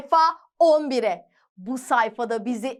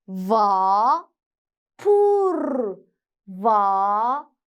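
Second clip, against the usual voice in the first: sounding out words; 6 semitones lower, much slower than usual. Shown as a demonstration. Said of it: "Birleştiriyoruz".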